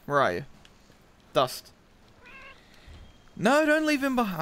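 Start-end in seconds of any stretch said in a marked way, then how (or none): 3.4-4.4 pitched-up pleading, falling away; dismayed plea. Real, not acted. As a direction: wail it in sudden dismay. Panicked protest during play.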